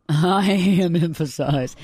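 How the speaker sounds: slow, drawn-out joke mantra; mock mantra. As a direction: stretch it out like a playful affirmation.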